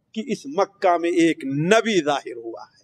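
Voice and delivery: ordinary talk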